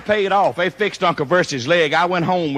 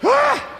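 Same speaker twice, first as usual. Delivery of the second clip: high gleeful "Ah!" over okra; delighted cry. Real, not acted. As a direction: yelp with pure relish. Delight over slimy boiled okra.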